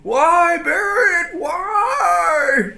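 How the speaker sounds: put-on high crying old man's wail; old man screaming. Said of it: "the old man screaming".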